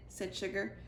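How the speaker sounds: usual speaking voice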